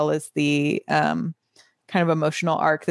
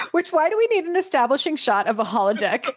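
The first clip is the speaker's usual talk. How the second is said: high, pitched-up comic protest; mock exasperation; 7 semitones higher, faster than usual. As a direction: lob it in as a laughing quip. Jokey mock complaint about the show.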